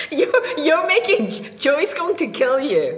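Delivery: high, laughing protest. Real, not acted. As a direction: giggle through the warning.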